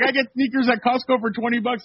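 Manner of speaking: mock old-timer through laughter; laughing imitation of reporter. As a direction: repeat his line while cracking up. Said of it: "He's like".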